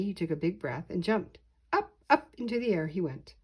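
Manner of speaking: ordinary delivery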